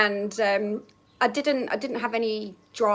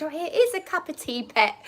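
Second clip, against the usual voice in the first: higher, a lightly voiced fond gran; doting granny; 6 semitones higher than usual. Gran offers her granddaughter tea, lightly higher. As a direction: offer it sweetly as an old gran.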